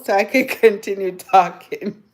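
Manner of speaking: laughing through the words. Real, not acted. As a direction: break into a laugh mid-phrase. Jokingly begging viewers for donations.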